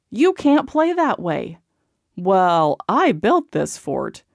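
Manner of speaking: put-on stamp it out like an indignant child; kids shouting. A narrator's reading. Siblings fight over the fort, loud and indignant. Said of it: "The arguing voices turned into shouts".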